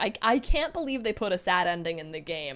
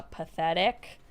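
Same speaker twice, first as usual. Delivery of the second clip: ordinary delivery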